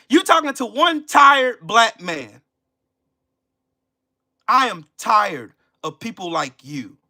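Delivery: ordinary talk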